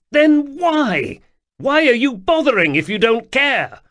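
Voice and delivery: high, loud accusing cry; upset yelling. Acted. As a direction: throw it at him in tears. Atropos pleading upset.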